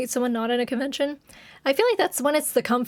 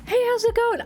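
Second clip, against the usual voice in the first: a light, higher mock greeting from a fan; imitating a fan; 10 semitones higher than usual. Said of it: "they're like".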